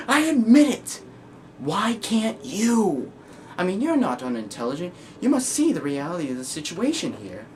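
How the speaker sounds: high, ranting madman; Joker. Acted. Joker demanding why you can't admit it, manic ranting. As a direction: challenge them with manic, swinging pitch.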